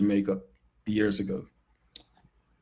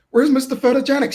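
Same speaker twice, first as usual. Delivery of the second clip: excited call out; 13 semitones higher, 6 dB louder than usual. Retold or imitated. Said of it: "some of the parents were like".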